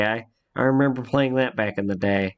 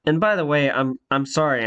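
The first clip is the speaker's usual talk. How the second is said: usual speaking voice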